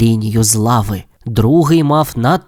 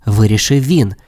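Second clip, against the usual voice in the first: plain reading voice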